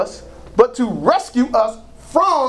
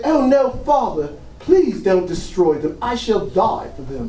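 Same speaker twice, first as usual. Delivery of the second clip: put-on movie Jesus British accent. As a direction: plead like a British film Jesus. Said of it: "British accent because a lot of the Jesus movies".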